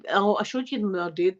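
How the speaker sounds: ordinary talk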